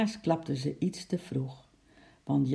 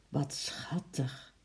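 slow, tender whisper; hushed, drawn-out adoration; softly; 8 dB quieter, slower than usual. A narrator's reading. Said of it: "zei Lotje zacht".

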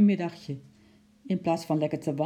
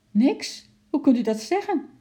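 loud, much higher indignant protest; an upset lady snapping back at him; indignant outburst; 9 semitones higher, 7 dB louder than usual. A narrator's reading. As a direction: flare up at the father. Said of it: "stoofde mevrouw nijdig op".

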